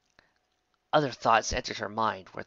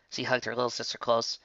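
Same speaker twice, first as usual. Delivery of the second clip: usual speaking voice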